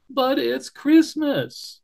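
high, slow, drawn-out moan. Acted. Barrington moans to the wolf, high and slow. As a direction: moan it miserably. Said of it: "moaned Barrington".

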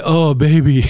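playful mock comfort to a crying wife; mock tender coo. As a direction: coo it like a smooth seducer. Voicing a comforting suitor.

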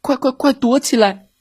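high urgent call; shouting to hide. A narrator's reading. Billy calling his friends to hide from the fox. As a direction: yell at everyone to hide. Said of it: "比利喊道".

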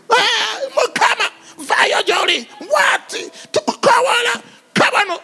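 mock frantic begging prayer. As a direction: babble your demands at God in a rush.

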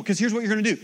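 ordinary talk, nothing marked

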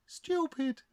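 very high, soft auntie voice; spirit auntie. Acted. Dead auntie echoing the others, high soft voice. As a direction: echo it high and soft as an aunt. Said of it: "this is Auntie".

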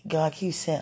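normal talking voice

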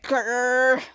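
low, drawn-out "grr"; imitated growl. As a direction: growl low like a cross toddler. Toddler growls in the retelling.

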